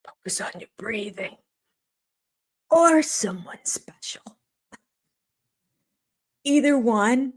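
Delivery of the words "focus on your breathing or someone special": imitated calming coach, dropping to a whisper; mock soothing advice. Mocking a cast member's calming breathing advice. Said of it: "listen to this one".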